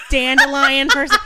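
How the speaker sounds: giggling through the question; laughing. Real, not acted. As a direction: laugh while asking, drawn out. Amused question about favorite crayon.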